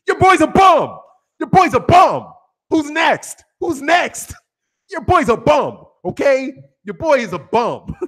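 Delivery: loud, high hype-man yelling; Bless Fight the Best impression. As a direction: bark the taunts like a fired-up boxing fan. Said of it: "Let me do my best impersonation of Bless Fight the Best".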